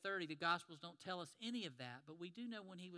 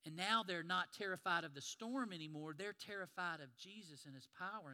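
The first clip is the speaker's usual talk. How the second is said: ordinary delivery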